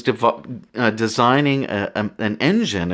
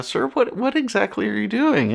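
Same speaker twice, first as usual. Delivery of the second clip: higher-pitched imitation of a puzzled zoo worker; mock-polite higher voice questioning a stranger; curious zoo worker; 11 semitones higher than usual. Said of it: "some guy sort of sidles up to him and says".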